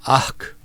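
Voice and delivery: "Ach" rasped hard from the throat like coughing up phlegm; phlegmy ach. Shown as a demonstration. Brother teaching orc sounds, rasps "Ach" from the throat. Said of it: "like you're about to cough up some phlegm".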